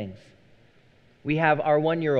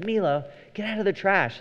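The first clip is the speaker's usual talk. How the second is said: high-pitched call telling the dog off; pitched-up mock scold at the dog; 8 semitones higher than usual. Owner calling the dog out of the trash, high mock scold.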